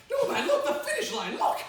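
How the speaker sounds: high, loud, insistent urging; urgent shouting. Acted. Rider on the runner's head points out the finish line, urgent shouting. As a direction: yell to make him look ahead.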